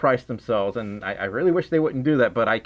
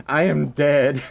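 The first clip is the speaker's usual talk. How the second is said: usual speaking voice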